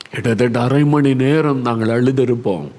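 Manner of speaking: ordinary delivery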